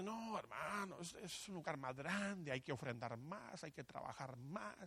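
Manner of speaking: soft, whiny mock churchgoer; mocking complainer. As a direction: mimic a lazy member making excuses. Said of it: "hay gente que dice".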